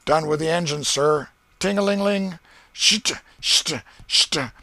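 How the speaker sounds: high loud call and hissing steam noises; boy playing steamboat engine. Ben answering as engine room, high and loud.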